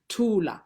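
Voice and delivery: Thula with a strong breathy T; aspirated T in Thula. Shown as a demonstration. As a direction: puff of air on the T of Thula. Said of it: "a strong T H".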